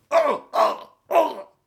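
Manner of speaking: string of high short yells; repeated test shouts. Pretend. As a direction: keep trying to shout convincingly. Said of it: "Ei se kuulosta yhtään hyvältä". (toistuvat koehuudot)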